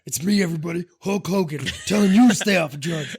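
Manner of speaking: hammed-up wrestler PSA; Hulk Hogan voice. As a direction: boom it like a wrestler warning kids. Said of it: "What is that voice?".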